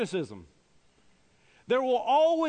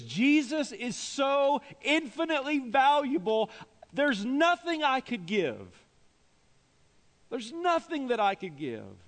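high, driving pulpit delivery; impassioned preaching; 6 semitones higher than usual. Real, not acted. Preacher urges congregation to be all in, impassioned. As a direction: build each phrase with fervent force.

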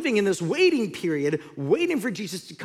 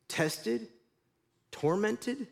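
ordinary talk

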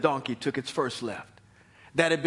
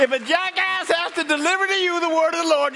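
usual speaking voice

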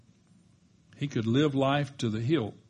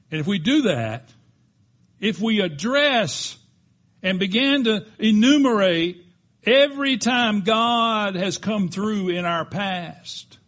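rising preaching build; 9 semitones higher, 9 dB louder than usual. Acted. Preacher urging the congregation, building to full-voiced preaching. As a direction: climb from firm to loud, high preaching.

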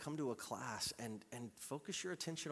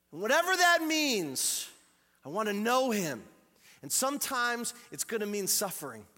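full-force preaching; 9 semitones higher, 10 dB louder than usual. Acted. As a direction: preach it out to the whole hall.

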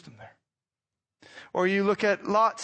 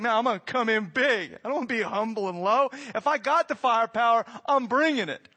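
boastful God; 4 semitones higher than usual. Retold or imitated. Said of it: "he'd be like".

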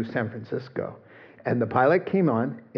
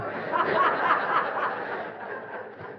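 quiet high unvoiced chuckle; soft self-mocking laugh; airy laugh; 4 dB quieter than usual. Real, not acted. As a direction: laugh at yourself softly. Laughing at not being forewarned.

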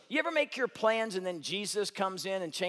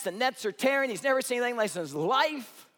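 ordinary talk

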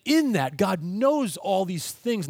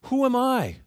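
high, pleading cry of doubt; desperate Moses; 9 semitones higher, 6 dB louder than usual. Retold or imitated. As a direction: ask it like an overwhelmed man begging to be spared. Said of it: "Moses does".

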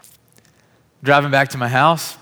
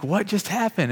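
much higher, bewildered; astonished question; 8 semitones higher than usual. Retold or imitated. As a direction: ask in amazed confusion. Healed man reacting.

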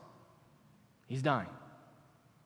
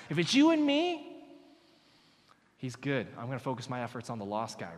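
ordinary talk

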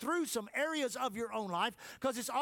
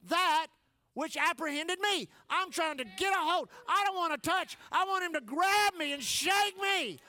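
loud, high, slow pleading build; fervent pulpit cry; fervent preaching; 7 semitones higher, 4 dB louder, slower than usual. Acted. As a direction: preach it like a plea to be seized. Preaching at full force.

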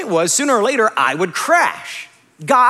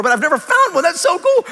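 high, thrilled exclamation; excited discovery; 9 semitones higher, 3 dB louder than usual. Retold or imitated. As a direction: marvel at your lucky find. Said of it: "We're all excited".

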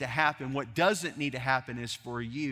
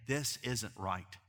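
quiet slow lament; 4 semitones lower, 6 dB quieter, slower than usual. Retold or imitated. Quiet voiced complaint.

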